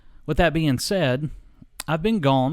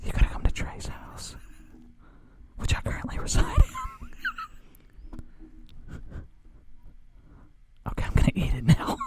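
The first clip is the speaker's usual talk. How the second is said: ASMR whisper. Acted. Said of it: "Just a little A S M R".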